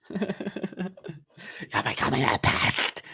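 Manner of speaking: high, slow, put-on madman; deranged killer. Killer accusing his wife, high deranged voice. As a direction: draw it out in a high voice.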